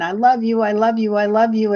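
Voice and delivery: a chorus of bright goodbyes; family goodbyes. Retold or imitated. Retelling the family's goodbyes, lightly excited. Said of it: "everybody's saying".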